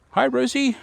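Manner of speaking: high sing-song chicken call. Real, not acted. Greeting her chickens.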